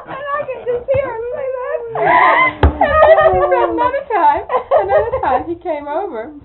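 high, loud, talking while crying with laughter; can barely speak for laughing; tearful laughter; laughing through tears. Real, not acted. Telling the story through laughter.

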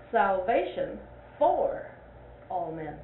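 pause, then punch for; stress on FOR. Shown as a demonstration. Teacher showing a second emphasis to the class. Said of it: "Where you put the emphasis".

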